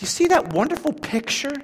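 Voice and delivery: high, loud shouted sermon; preaching at full force. Acted. Preacher proclaiming Romans eight to the church, shouting. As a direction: thunder Paul's words at the congregation.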